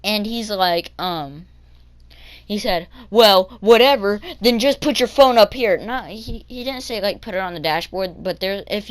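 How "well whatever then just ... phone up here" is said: loud, higher mock order; imitated exasperation. Bus driver demanding kid's phone, loud imitated exasperation. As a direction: boss the kid, imitating a fed-up driver.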